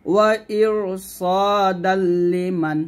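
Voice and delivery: ওয়া ইর সা দাল্লিমান slowly, with a thick, heavy R in ইর; heavy R in tajweed recitation. Shown as a demonstration. Teacher to students, demonstrating a slow recitation with a heavy R. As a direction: stretch it out and thicken the R of ইর. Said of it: "এরকম".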